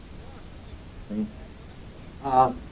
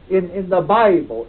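usual speaking voice

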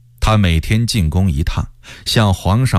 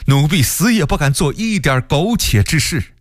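high, earnest vow of loyalty; pleading, high-pitched assurance; fearful servant; 6 semitones higher than usual. A narrator's reading. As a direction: swear it anxiously to the emperor. Frightened servant swears loyalty to emperor.

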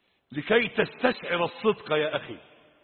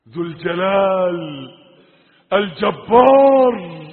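loud, swelling divine titles; majestic proclamation; 12 dB louder, slower than usual. Real, not acted. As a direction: let the last title ring out, long and loud. Swelling list of God's names. (تعظيم بصوت جهوري ممدود)

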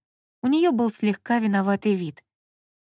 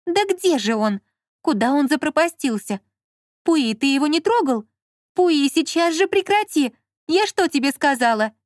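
higher-pitched, exasperated scolding; irritated; 6 semitones higher, 4 dB louder than usual. A narrator's reading. Lola hunts in the next room, scolding Pui irritably. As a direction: snap at a meddling pet, voice raised in pitch. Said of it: "послышался раздражённый Лолин голос".

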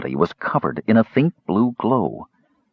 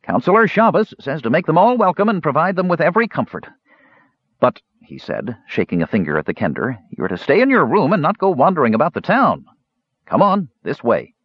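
stern innkeeper; 8 semitones higher than usual. A narrator's reading. Proprietor scolding Kender, finger shaking.

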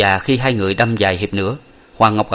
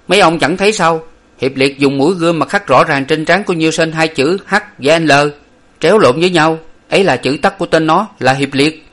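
higher, louder pointing-out; explaining master; 7 semitones higher, 4 dB louder than usual. A narrator's reading. Master points out the carved letters to onlookers, loud and high. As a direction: show them the mark with authority.

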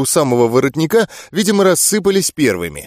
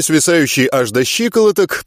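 ordinary delivery

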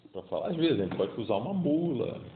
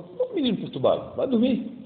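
higher, louder annoyed scolding; irritated old priest; 11 semitones higher, 5 dB louder than usual. Retold or imitated. Eli scolding the boy who keeps waking him, irritated. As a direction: snap at the boy to go to sleep. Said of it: "o próprio Eli, né, fala".

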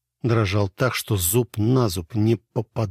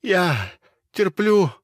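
weak plea; 7 semitones higher, 4 dB louder, slower than usual. A narrator's reading. Near-fainting plea.

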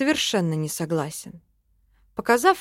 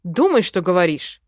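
loud, offended warning; affronted elf telling someone off; indignant; 6 dB louder than usual. A narrator's reading. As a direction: rebuke sharply at raised volume. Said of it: "возмутился эльф".

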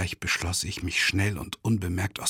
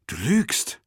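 höher und lauter, anklagend; innere Stimme; 5 semitones higher, 4 dB louder than usual. Acted. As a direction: die Gewissensstimme fährt dazwischen. (anklagende innere Stimme)

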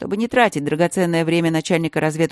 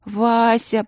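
высоко и протяжно; позвать сестру с мольбой; умоляюще; 6 semitones higher than usual. A narrator's reading. Упрашивает сестру. (Алинка умоляет королеву)

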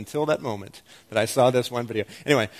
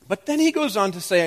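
usual speaking voice

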